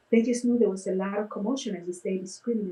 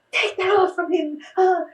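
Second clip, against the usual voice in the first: high, loud furious demand; mock yell of an outraged grandmother; angry shout; 11 semitones higher, 7 dB louder than usual. Grandmother yelling at the face painter, mock furious shout. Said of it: "she's screaming and yelling".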